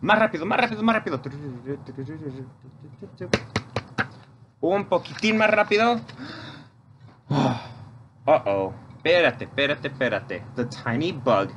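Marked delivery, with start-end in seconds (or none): 0.0-6.0 higher, pushing the dance along; urging faster. Shown as a demonstration. Leader cheering kids on during chicken dance, excited urging. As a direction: hurry them up, cheering. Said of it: "Dance faster".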